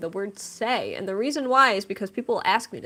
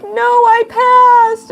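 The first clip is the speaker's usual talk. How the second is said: high, loud, slow tearful wail; mock crying wail; 14 semitones higher, 9 dB louder, much slower than usual. Crying driver correcting her boyfriend, mock tearful wail. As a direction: wail the good news, stretching "passed" in mock sobs. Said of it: "he saw me crying".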